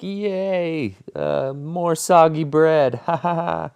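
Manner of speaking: high, slow, mocking fake cheer with a fake laugh; sarcastic cheer. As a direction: sneer out a fake happy yay and a hollow laugh. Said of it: "I was like".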